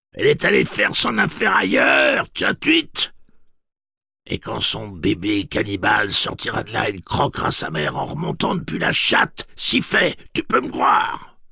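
shrill, high, gloating witch shriek; cackling hell torturer. Acted. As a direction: taunt him like a hellish hag. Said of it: "Elle éclata de rire ... rire grinçant de la sorcière".

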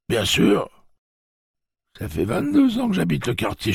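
put-on very high, loud cracked old man; old man's voice. Old man correcting Jake, high loud old voice. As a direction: play a cranky old man.

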